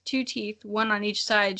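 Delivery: normal talking voice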